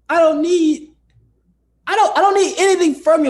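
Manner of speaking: exasperated, insisting you just want to vent; emphatic insistence. Real, not acted. Telling the host she just wants to be heard, exasperated. Venting about not wanting solutions.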